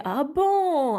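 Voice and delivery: ordinary talk, nothing marked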